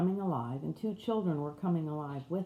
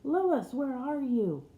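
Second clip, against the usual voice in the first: much higher and louder, urgent call; 7 semitones higher, 5 dB louder than usual. Acted. Husband calling back to his wife's voice, urgent. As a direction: cry out to her across the dream.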